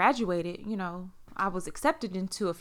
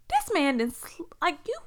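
ordinary delivery

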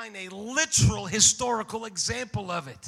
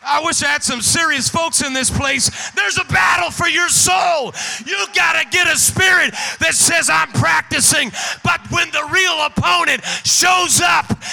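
loud, higher shouted preaching; preaching at full force; 4 semitones higher, 8 dB louder than usual. Acted. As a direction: whoop and holler it to the church. Full-force climax of the sermon.

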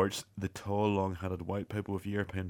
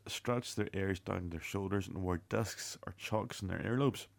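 usual speaking voice

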